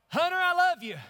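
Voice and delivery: preaching at full force. Real, not acted. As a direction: call it across the church with force. Shouted to congregants.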